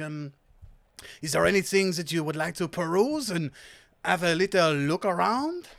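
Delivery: put-on lilting French-accented shopkeeper. Fed-up seller welcomes three customers, French accent. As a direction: welcome them as a theatrical French stallholder. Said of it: "I will do this accent".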